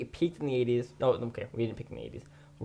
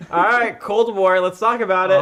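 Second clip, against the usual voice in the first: announcer voice; 7 semitones higher, 11 dB louder than usual. Acted. Opening a new podcast segment.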